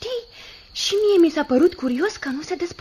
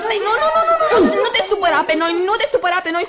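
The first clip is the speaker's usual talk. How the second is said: high, hurried repeated begging; frantic pleading; 3 semitones higher, 4 dB louder, faster than usual. Acted. Caught girls begging the guest not to be angry, frantic. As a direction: plead urgently, piling up the words.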